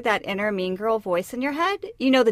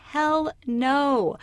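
ordinary talk

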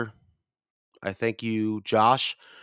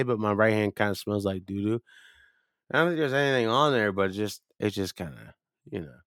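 usual speaking voice

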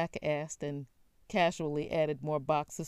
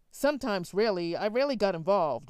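higher, louder, fast and flustered; nervous stutter; 4 semitones higher, 6 dB louder, faster than usual. A narrator's reading. Son caught out by his father, stuttering fast and higher. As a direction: trip over the words, caught out. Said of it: "Will stuttered".

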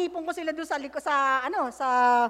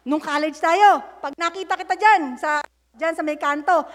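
quoting classmate; 8 dB louder than usual. Retold or imitated. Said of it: "Sabi niya ganun".

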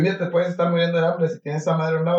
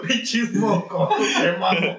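slow, higher, laughing through Pinches mocosos; 6 semitones higher, slower than usual. Real, not acted. Friend repeats the phrase again, laughing hard. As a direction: savor it while cracking up.

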